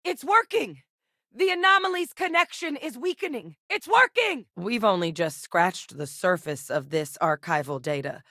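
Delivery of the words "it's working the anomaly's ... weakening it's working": high-pitched, urgent elation; excited shouting. Acted. Telling the team the anomaly is weakening, excited shout.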